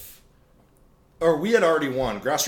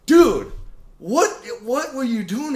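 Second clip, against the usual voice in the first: loud high "dude", then baffled question; incredulous; 9 semitones higher, 6 dB louder, slower than usual. Retold or imitated. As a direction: demand an explanation in amazed disbelief. Retelling confronting the rival afterwards.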